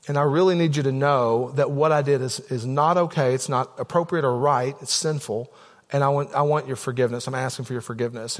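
low, subdued confession; sincere apology. Retold or imitated. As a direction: humbly ask your child to forgive you. Father retells asking son's forgiveness.

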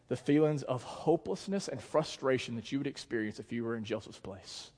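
usual speaking voice

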